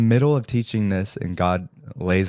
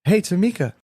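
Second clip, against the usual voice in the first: high, bright casual hello by name; friendly hey across the counter; cheery greeting; 12 semitones higher, 4 dB louder than usual. Retold or imitated. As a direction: toss out her name brightly. Re-quoting his own greeting.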